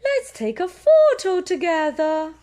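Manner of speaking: high loud admiring voice; teacher voice. Acted. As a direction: praise the class warmly. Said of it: "Madame Gazelle says".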